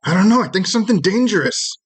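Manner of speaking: high, quick little voice; worried tiny creature. Acted. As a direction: blurt it in a small, high voice. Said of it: "He goes".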